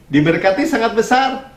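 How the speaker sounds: ordinary talk, nothing marked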